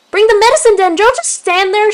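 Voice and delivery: very high, loud urgent cry; howled. A narrator's reading. She-cat demanding help from bystanders, howling high and loud. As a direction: howl for help. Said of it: "she would howl".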